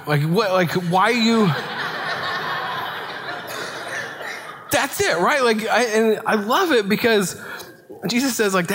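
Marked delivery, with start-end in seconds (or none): 1.5-4.7 high airy burst of laughter; breathy laugh. Real, not acted. Pastor laughing at his daughter's line, real laugh.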